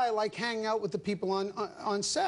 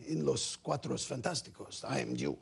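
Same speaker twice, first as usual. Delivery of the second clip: put-on low, quiet Mexican-accented voice; 6 semitones lower, 6 dB quieter than usual. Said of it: "He goes".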